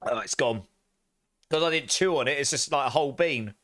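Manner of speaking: ordinary talk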